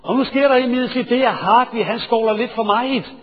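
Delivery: ordinary delivery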